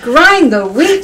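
slow, careful echo of the words; slow repeat. Shown as a demonstration. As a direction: say it back slowly.

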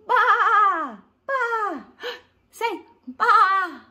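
loud high bleating baa; sheep baa. Shown as a demonstration. Teacher bleating like a sheep for the class. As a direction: bleat it out like a lamb. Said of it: "Let's say ba to the sheep".

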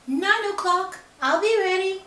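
high, loud little pig. Acted. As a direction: chirp it eagerly. Pig replies.

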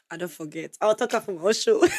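ordinary talk

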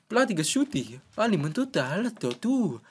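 high-pitched gibberish with swooping tune; Sims language. Acted. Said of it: "they're just talking the Sims language".

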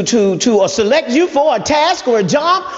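ordinary delivery

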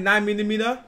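ordinary delivery

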